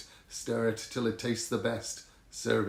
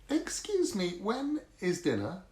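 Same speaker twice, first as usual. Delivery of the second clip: put-on little girl voice; 7 semitones higher than usual. Little sister asks pirates.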